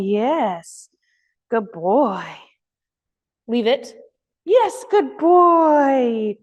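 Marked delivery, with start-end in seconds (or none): 4.5-6.4 higher, slow, drawn-out praise; delighted dog praise; excited praise. Real, not acted. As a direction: stretch it out to reward the dog.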